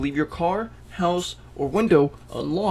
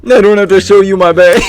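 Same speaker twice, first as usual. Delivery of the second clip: laughing; 5 semitones higher, 13 dB louder than usual. Retold or imitated. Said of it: "I love that response".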